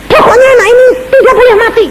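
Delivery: frantic family insisting; insistent demand. Retold or imitated. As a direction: plead urgently that he can't die. Family insists child must not die.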